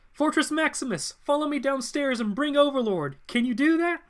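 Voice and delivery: very high, urgent acted voice; high dramatic hero voice; calling out orders. Acted urgent call across a fight.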